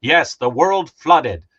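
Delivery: slow, loud, grandly mock-solemn; hammed-up doom proclamation; mock dramatic announcement. Mocking comic publishers, slow and grand. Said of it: "They're like".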